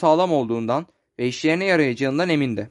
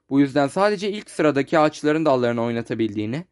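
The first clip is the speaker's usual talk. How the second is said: usual speaking voice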